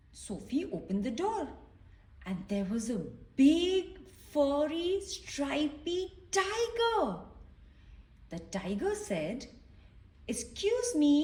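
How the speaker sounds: gentle read-aloud voice for little ones; storybook reading. A narrator's reading. Reading a picture book to children, gently storytelling.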